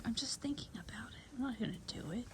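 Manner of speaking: whispered horse's reply. Retold or imitated. Vlogger voices the horse caught at an ear, whispering. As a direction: whisper it as the horse talking back. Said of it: "She's like".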